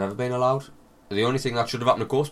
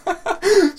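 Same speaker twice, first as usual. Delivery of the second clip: high laugh; 7 dB louder than usual. Real, not acted. Laughing at own ad.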